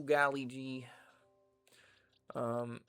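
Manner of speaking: ordinary talk, nothing marked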